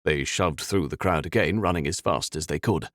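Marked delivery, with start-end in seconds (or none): none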